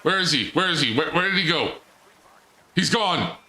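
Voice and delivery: high, urgent disbelief; alarmed searching. Real, not acted. Player unable to find the vanished robot, alarmed.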